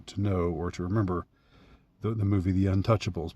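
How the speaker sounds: ordinary talk, nothing marked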